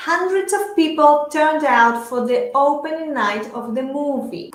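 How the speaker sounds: slow, carefully paced English model sentence; measured language-teacher read-aloud; shadowing model reading. Shown as a demonstration. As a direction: read it slowly for learners to repeat. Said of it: "yo leo las frases y ustedes repiten".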